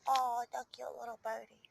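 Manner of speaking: normal talking voice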